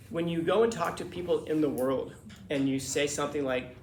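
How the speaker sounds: normal talking voice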